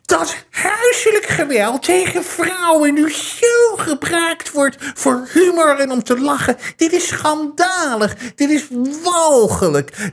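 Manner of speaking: high, slow, wailing fake indignation; mock outrage. Imitating what outraged people would say now, high and slow. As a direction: ham up the scandalised critic. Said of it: "Maar dan zou je nu ook zeggen".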